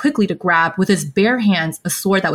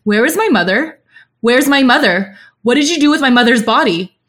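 higher, louder panicked demands; Xie Liang's panicked yell; 5 semitones higher, 4 dB louder than usual. A narrator's reading. Xie Liang to the child over his mother's body, panicked yell. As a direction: cry it out in panic, voice raised. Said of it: "His voice is panicked as he yells".

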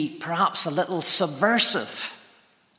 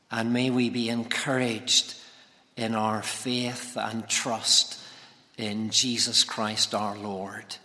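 soft, reverent prayer, fading lower and quieter to the amen; hushed pulpit prayer; spoken prayer; 3 semitones lower, 3 dB quieter than usual. Acted. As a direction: pray gently and humbly before the sermon. Preacher leads an opening prayer.

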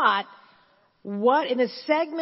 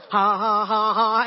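forced laugh; 6 dB louder than usual. Retold or imitated. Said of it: "my joy exercise".

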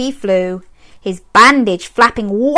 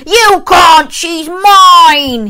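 loud higher angry roar; Zog roaring; 7 semitones higher, 6 dB louder, much slower than usual. A narrator's reading. Zog defending his princess from the knight, loud. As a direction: bellow possessively. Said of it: "he roared".

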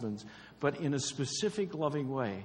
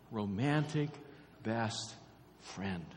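each word said separately, falling and softer; spaced emphasis; 4 semitones lower, 5 dB quieter, slower than usual. Real, not acted. Preacher to husbands, spacing out each word. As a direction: lay "romantic", "best", "friend" down one at a time.

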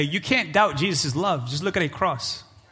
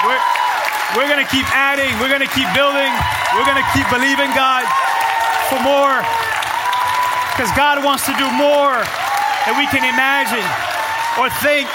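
loud, raised preacher's delivery; impassioned preaching; 5 semitones higher, 3 dB louder than usual. Real, not acted. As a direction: preach in short, forceful bursts with pauses. Preaches at full force.

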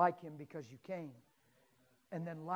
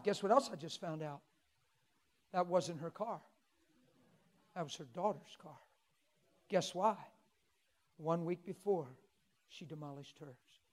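ordinary talk

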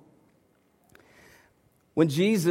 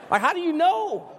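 high-pitched mock disbelief; high, comic incredulous question; 8 semitones higher than usual. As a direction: ask it high, with exasperated doubt. Comic doubt over son's conversion.

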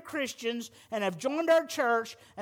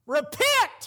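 Repent said as a firm falling order, louder; stern command; 3 semitones higher, 3 dB louder than usual. Retold or imitated. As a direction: give Repent as a blunt command. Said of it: "he says".

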